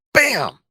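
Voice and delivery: high, punchy "bam" for a hit; mimed impact. Acted. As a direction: voice the elbow smash landing. Acting out the elbow smash.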